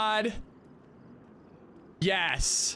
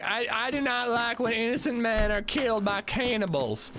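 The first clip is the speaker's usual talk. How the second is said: higher folksy hillbilly twang; 5 semitones higher than usual. Acted. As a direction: play a rootin' tootin' hillbilly. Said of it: "the most hillbilly I can do".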